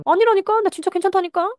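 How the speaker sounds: losing patience, push the point hard; exasperated insisting. Acted. Mother losing patience with her doubting child, high and loud.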